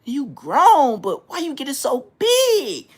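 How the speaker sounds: loud, high scolding mom; imitating his mom's loud shocked scold. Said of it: "That's how she said it".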